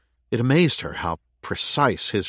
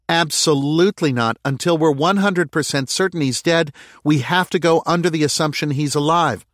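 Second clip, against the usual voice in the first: raised, high insistent refusal; stubborn mayor; 8 semitones higher, 5 dB louder than usual. Acted. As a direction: dig in, higher and louder.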